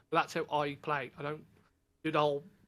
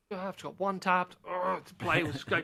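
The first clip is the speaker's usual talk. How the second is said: louder, higher griping with a disgusted grunt; mock gamer rage; 4 semitones higher, 4 dB louder than usual. Gamer re-enacting getting one-tapped, angry and exasperated. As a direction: act out an exasperated outburst at the game. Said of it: "My wife said I used to get angry".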